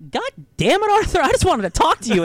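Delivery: high, loud frustrated yell; mock-furious snap at a gloomy friend; hammed-up fed-up woman; exasperated outburst. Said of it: "and she's just like".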